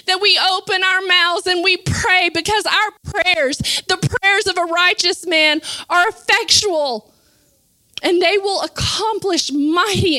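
impassioned preaching. Real, not acted. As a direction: preach it with mounting force. Preaches at full force.

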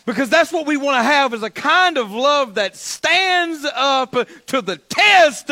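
loud, high, driving preacher voice; full-force preaching. Real, not acted. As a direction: preach it hard, drawing out the key words.

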